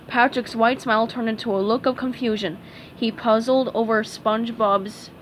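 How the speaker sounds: ordinary delivery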